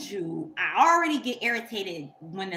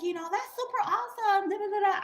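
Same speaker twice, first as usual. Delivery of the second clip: high, sugary fake praise; high mock-impressed gushing; fake enthusiasm; 12 semitones higher, faster than usual. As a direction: gush insincerely to play it off. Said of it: "I just played it off".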